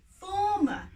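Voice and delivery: high, loud call. A narrator's reading. Friend calling out to the farmer, loud. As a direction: call his name firmly.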